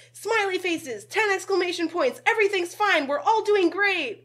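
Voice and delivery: high, loud, slowing gush of positivity; bubbly email voice, laid on thick; mock chirpy exclamation-point voice; overly cheerful. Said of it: "I'm like".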